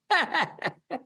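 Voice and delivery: a sudden high laugh at his own joke; helpless high-pitched laugh. Real, not acted. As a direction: burst of high giggling. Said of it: "I don't know why I'm laughing".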